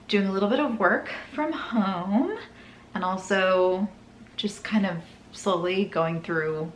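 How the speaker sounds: normal talking voice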